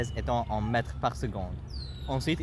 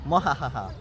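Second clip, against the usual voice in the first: theatrical mwahaha cackle; gloating cackle; evil villain laugh. Acted.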